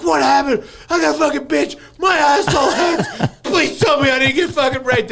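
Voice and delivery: high, loud, panicked phone call; calling a friend at dawn, panicked and loud; freaking out. Retold or imitated. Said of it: "freaking out".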